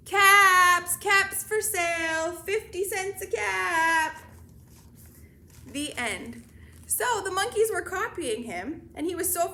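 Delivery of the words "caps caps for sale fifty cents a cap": loud, high sing-song peddler cry; street vendor's call. A narrator's reading. Peddler back in town hawking caps, calling out. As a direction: draw out each word, calling wares. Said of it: "walked back to town calling".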